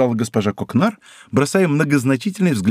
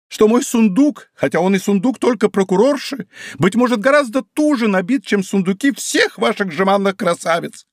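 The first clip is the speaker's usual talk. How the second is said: higher, proud woman's voice; offended wife; 8 semitones higher than usual. Acted. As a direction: boast of her full chest, stung. (задетая жена прокурора, выше)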